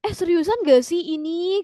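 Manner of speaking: high incredulous question; acted amazement, rising end; disbelief. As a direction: demand to know if it's for real. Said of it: "Kayak nggak nyangka gitu ya".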